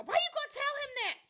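high, loud mock protest; imitated outraged outburst. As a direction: squawk it like a scandalized daughter. Acts out daughter's outburst.

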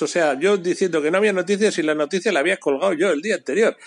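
ordinary delivery

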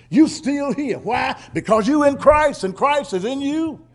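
ordinary talk

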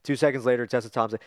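ordinary talk

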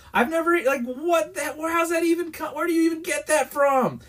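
high-pitched, sputtering questions; exasperated disbelief. Retold or imitated. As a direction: sputter in baffled protest. Exasperated at absurd impression request.

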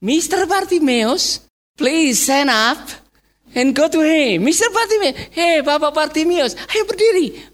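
high, sweetly courteous summons; changed, polite tone. Acted. Crowd now politely inviting Bartimeus, high courteous tone. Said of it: "bahasanya sudah berbeda".

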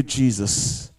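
normal talking voice

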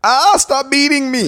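high, slow, pleading cry. Pretend.

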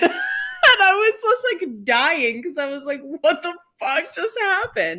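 high-pitched, squeaky talk through laughter; helpless laughter. Retold or imitated. Friend retelling reaction to choking text, dying laughing. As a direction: push the words out while cracking up.

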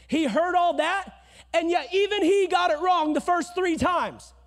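loud, high fiery sermon; full-force preaching. Real, not acted. Pastor rousing the congregation, fiery and loud.

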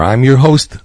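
ordinary delivery

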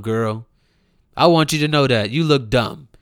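ordinary talk

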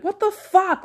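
very high comic outrage; hammed-up instant blow-up, high squawk; mock outburst. Prank-show victim at first offence, mock outrage.

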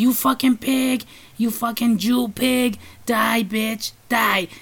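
a lightly put-on eerie voice, dragged out slow on the slur; creepy train woman. Retelling the screaming woman on the subway, in a lightly imitated creepy voice. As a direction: imitate the crazy woman's creepy voice, half-hearted. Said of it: "with that voice".

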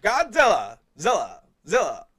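mock movie shout. Mock monster movie announcement.

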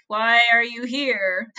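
loud, slow, baffled question; hammed-up bewilderment; mock disbelief. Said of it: "Dylan's sudden inability to speak".

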